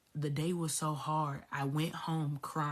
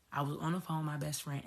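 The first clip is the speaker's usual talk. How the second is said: usual speaking voice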